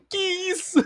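very high squeaky laughing question; breathless laughing disbelief. Real, not acted. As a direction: gasp it out mid-laugh. Laughing at the joke.

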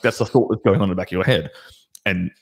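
ordinary delivery

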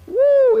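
loud, high-pitched whoop; sudden high, loud "wooo"; high "woo". Retold or imitated. Preacher recalling the windy day two days ago, high whoop.

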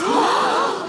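shocked gasp. Acted. Shocked accusation scene.

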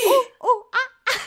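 high hooting monkey calls; monkey noises. Pretend. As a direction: hoot like an excited monkey. Charades, making monkey noises.

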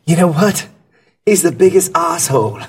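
dishing the dirt in a lifted voice; a hint of an insider spilling a secret; coworker quoted. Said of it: "He's like".

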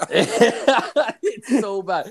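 high-pitched burst of laughter through the words; can't stop laughing while saying it; laughing hard. Real, not acted. Laughing about his rotting tub.

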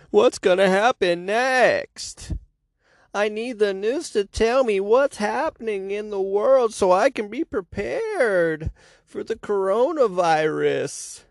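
high, slow fretful voice; scared viewer. Retold or imitated. Mocking fearful viewers, high anxious whine. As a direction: whine it like a frightened TV addict.

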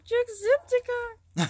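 high, loud, drawn-out imitation of an excited fan; mock fanboy cry; freaking out. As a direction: squeal the name slowly, imitating a fan's freak-out. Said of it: "freaking out because he was like".

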